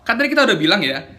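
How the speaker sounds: ordinary delivery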